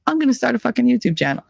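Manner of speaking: high, fast burst of determination; excited, high declaration; fired-up resolve. Retold or imitated. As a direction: blurt it out like a sudden decision. Sudden decision to start channel.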